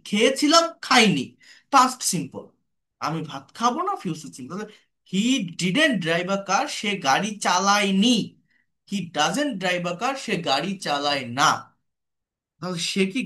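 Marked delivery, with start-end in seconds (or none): 5.1-8.2 slow dictation. Shown as a demonstration. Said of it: "teacher dictating slowly".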